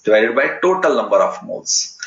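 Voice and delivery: ordinary talk, nothing marked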